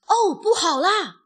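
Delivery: high, loud cry of alarm; panicked shout. A narrator's reading. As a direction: wail out the bad news in panic. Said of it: "惊慌地大喊道". (惊慌地大喊)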